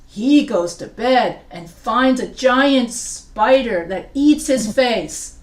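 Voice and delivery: hammed-up spooky twist, slow and loud; dramatic storytelling. Shown as a demonstration. Teacher jokingly dramatizing a spider attack for the class, loud and slow.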